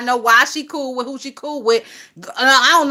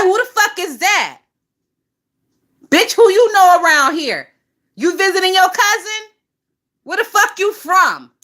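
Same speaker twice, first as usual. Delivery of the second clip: higher, louder, confronting an intruder; mock street challenge; 6 semitones higher, 4 dB louder than usual. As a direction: grill the stranger like they crossed your block. Acted street challenge to a newcomer.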